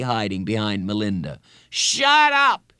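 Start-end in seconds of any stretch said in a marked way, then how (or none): 1.7-2.7 put-on very high, loud boy's yell; shrieked schoolboy outburst. A narrator's reading. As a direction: yell it to silence the teasing. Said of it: "he yelled".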